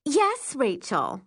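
high start dropping into a mild purr. A narrator's reading. Liana needles Rachel over the bottle, mildly purring. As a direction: purr it with mock sweetness. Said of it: "Liana purrs".

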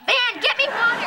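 high whiny demand; imitating a sick pregnant girl; whiny demanding daughter. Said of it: "it sounds like this".

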